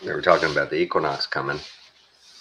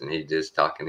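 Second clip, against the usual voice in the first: ordinary talk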